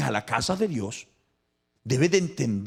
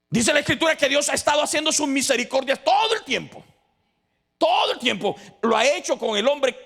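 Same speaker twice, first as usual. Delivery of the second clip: high, loud fervent preaching; rally the congregation, voice lifted; preaching at full force; 10 semitones higher, 4 dB louder than usual. Acted. Preacher rallying the congregation, high and loud fervent call.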